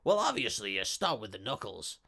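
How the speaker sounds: a low, menacing gangster voice; lightly voiced menacing gangster; menacing villain. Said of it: "you hear him go".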